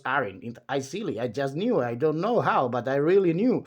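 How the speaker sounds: high, babbling chatterbox voice; a high chatty voice that never stops; endless chatterer. Acted. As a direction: ramble on brightly without pausing for breath. Chattering character voice.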